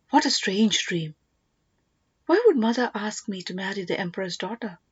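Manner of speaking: ordinary delivery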